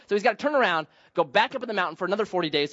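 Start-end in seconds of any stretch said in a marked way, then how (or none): none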